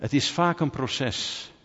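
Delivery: ordinary talk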